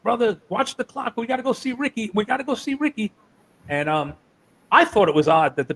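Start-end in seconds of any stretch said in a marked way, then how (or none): 0.0-3.1 high-pitched, eager impression of a wrestler; raised, put-on voice of an excited veteran; Dusty. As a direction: imitate him high, urging a friend along. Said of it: "Dusty's all about it. He's like".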